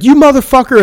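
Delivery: high-pitched imitation of an angry woman; indignant mimicked outburst; mock outraged yell. Retold furious girl in bar dispute.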